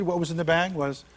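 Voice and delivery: ordinary talk, nothing marked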